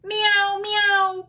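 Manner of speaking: high, loud drawn meows; cat meowing. A narrator's reading. Teacher voicing the cat for children, high loud meowing. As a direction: cry out like a storybook cat. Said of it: "cried the cat".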